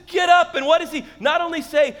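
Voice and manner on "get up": raised, loud insistence; proclaimed command. Acted. Preacher repeating God's message, loud and insistent. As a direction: drive the command home, high and loud.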